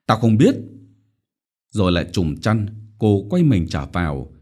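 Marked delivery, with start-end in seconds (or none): 0.1-0.5 sharp irritated snap; annoyed refusal. A narrator's reading. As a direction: cut her off crossly. (bực bội gạt đi)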